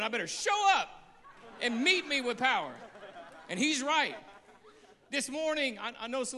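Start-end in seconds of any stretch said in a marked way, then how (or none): 0.0-2.7 mock God voice. God voiced as answering Michael.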